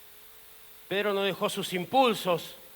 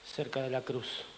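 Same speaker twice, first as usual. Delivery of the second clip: low, hushed confession; soft and low, weighed down by regret; quiet regret; 8 semitones lower, 16 dB quieter than usual. Acted. Peter regrets missing the cross, quiet and regretful.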